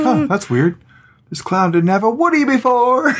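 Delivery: high, laughing mock-mom voice; mom's puzzled joke. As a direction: play the mom, cracking up mid-line. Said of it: "She's like".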